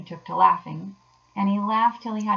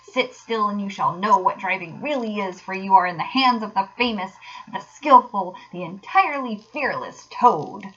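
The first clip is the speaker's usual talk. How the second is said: loud, high boastful crowing easing into grand emphasis; swaggering, swooping shout; reckless boasting; 4 semitones higher, 8 dB louder than usual. A narrator's reading. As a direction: proclaim your own glory with abandon. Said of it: "he shouted recklessly".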